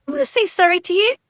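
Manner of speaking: high, loud, sneering grudging sorry; sarcastic mock apology. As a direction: spit it out like a sulky kid forced to apologize. Angry mock reply to husband.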